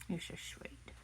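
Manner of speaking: soft whispered "You're so sweet." to the pet. Real, not acted. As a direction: breathe the words to the dog. Cooing to the pet.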